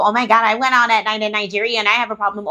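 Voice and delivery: lightly voiced bragging know-it-all; mock show-off. Mocking viewers who went out at night, smug bragging voice. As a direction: sneer it as a smug boaster. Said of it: "you people that wanna be so different".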